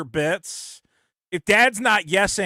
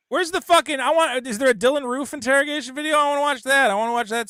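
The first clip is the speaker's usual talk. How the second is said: worked-up rant; 8 semitones higher, faster than usual. Real, not acted. Excited rant about true crime.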